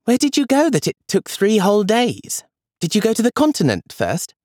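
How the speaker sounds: put-on high, curious girl voice; child Julia. A narrator's reading. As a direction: ask like an eager little girl, high. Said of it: "Julia said".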